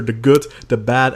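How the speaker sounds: ordinary talk, nothing marked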